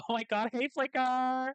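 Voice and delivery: high-pitched, exclaiming hello; gushing greeting. Acted. Regina greeting her travelling companion Flicker, gushing. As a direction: greet them in a put-on high voice. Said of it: "she's like".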